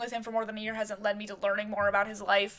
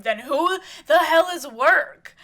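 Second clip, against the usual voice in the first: slow full scream, much louder and higher; screaming back; 7 semitones higher, 10 dB louder, slower than usual. Acted. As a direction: throw every word at him in a rage. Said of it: "Amy's screaming back at him".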